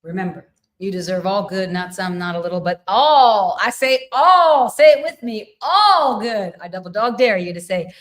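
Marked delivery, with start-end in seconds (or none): 2.6-6.6 high, loud, drawn-out ALL; rally the crowd to chant along, loudly; rousing exhortation. Real, not acted. Rallies listeners to say it along.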